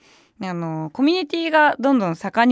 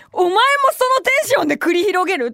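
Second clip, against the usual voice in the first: incredulous retort; 8 semitones higher than usual. Retold or imitated.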